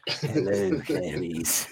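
very low, slow menacing voice; deep pitch shifted. Retold or imitated. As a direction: drop deep like a voice changer. Quoting Hannibal line again.

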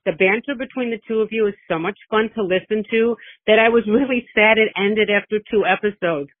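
ordinary delivery

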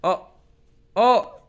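high, loud panicked cries; turning into Smith. Pretend. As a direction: cry out high as you transform. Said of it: "I'm turning into Smith".

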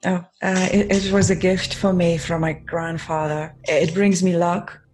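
ordinary delivery

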